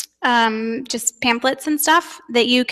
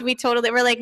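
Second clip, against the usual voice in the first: ordinary talk, nothing marked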